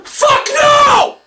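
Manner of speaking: loud high shout; high-pitched yelled no; shouted refusal. Acted. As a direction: scream the refusal at him. Girl refuses loudly.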